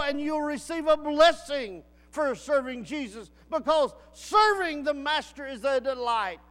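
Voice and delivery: loud rising pulpit exhortation; preaching at full force. Real, not acted. Preacher urging the church to serve, fervent. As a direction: preach it hard, push the congregation.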